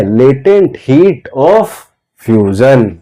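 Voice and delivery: drawn out, pausing before fusion; slow drilled term. Shown as a demonstration. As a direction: hold back the last word, then drop it. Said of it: "यही क्या है?". (धीरे-धीरे, fusion से पहले रुककर)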